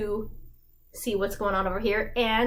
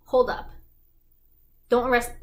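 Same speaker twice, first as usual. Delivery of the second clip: louder, firm halt; commanding call; 5 dB louder, faster than usual. Retold or imitated. Mayor Valjean halting Javert, loud command. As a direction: stop the arrest with authority.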